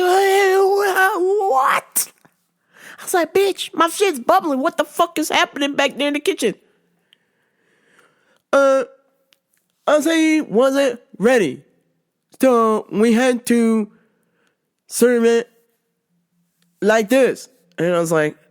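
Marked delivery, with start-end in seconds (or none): 0.0-6.5 shocked outrage. Retold or imitated. Shocked at boiling acai. 8.5-17.5 lightly voiced coworker. Imitating the coworker's excuse.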